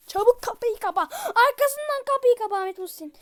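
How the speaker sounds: from urgent to high, loud screaming; begging the monster to stop, screaming; panicked yelling. Real, not acted. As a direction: yell for the door in rising panic. Monster chasing, yelling at character.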